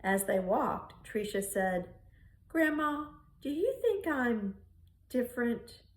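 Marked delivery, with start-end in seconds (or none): 2.5-5.8 put-on little girl voice. Little girl asks her grandma.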